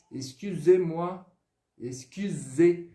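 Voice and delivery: excusez-moi drawn out slowly and politely. Shown as a demonstration. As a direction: ask an old man politely, slowly. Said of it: "a diferença na pronúncia". (excusez-moi polido e pausado)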